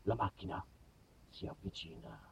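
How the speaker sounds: low, soft thriller narration; mock nature-documentary hush; hushed suspense. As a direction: build the tension in a near whisper.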